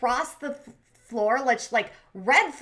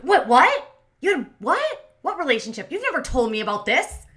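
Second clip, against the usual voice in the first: shocked disbelief; 3 semitones higher, 7 dB louder than usual. Retold or imitated. Retelling girlfriend's reaction to hidden relationship, shocked disbelief.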